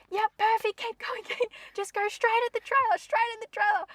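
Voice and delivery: high, bright mock coaching voice; imitating Twig. Friend imitating Twig guiding her to the trailer, high mock cheer. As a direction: chirp the directions like an eager guide. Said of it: "Twig's going".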